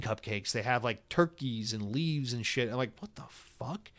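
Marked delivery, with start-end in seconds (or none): 3.0-3.9 breathless whispered disbelief; stunned whisper. Retold or imitated. To himself in the store, a stunned whisper. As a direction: mouth it in shocked disbelief. Shocked at Thanksgiving cupcakes.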